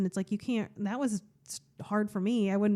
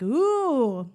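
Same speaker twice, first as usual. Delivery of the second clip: excited ooh; 5 semitones higher, 6 dB louder than usual. Retold or imitated. Finding something she likes.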